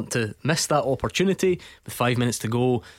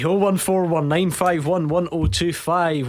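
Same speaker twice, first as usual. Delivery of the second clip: louder, upbeat presenter read of the number; radio phone-in read; 4 semitones higher, 3 dB louder than usual. Acted. Phone-in host pushing the number to callers, upbeat. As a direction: sell the phone line to listeners.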